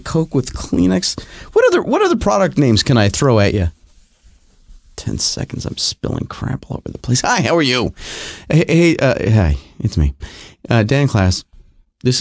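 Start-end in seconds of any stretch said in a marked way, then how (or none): 5.0-7.2 whispered aside. Real, not acted. Aside while wiping spill.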